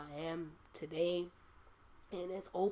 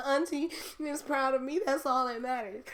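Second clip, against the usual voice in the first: high, loud, laughing and choking up; half laugh, half cry, overjoyed; real tears breaking into laughter; laughing through tears; 7 semitones higher, 9 dB louder than usual. Real, not acted. Tearful joy recalling auntie's approval.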